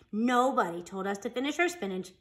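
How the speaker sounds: plain reading voice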